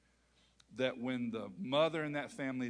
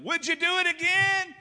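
much higher mock wife; high imitation of wife asking; wife's voice; 8 dB louder than usual. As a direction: ask as the wife, high pitched. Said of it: "She didn't say anything like that".

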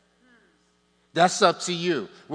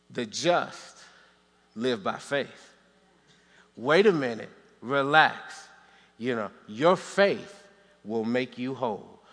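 calm voice of God; 5 semitones lower than usual. Acted. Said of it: "God is going".

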